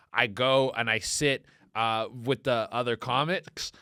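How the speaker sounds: ordinary talk, nothing marked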